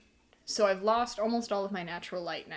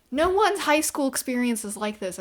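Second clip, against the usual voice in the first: exasperated; 4 semitones higher, 9 dB louder than usual. Real, not acted. Ranting about unrealistic plot.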